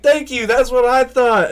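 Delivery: retold boss's triumphant shout. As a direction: shout it like you knew all along. Tim vindicated in story.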